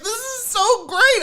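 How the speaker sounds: very high, drawn-out mock gush; slow, high-pitched retold swooning; emotional gushing. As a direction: gush as if moved by a TV wedding. Retells gushing at sitcom finale.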